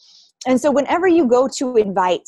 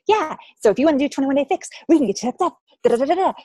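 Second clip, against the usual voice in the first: higher-pitched, quick mock sales patter; imitating enthusiastic coaches; 5 semitones higher, faster than usual. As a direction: gush like eager new coaches. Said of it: "like, enthusiastic new coaches".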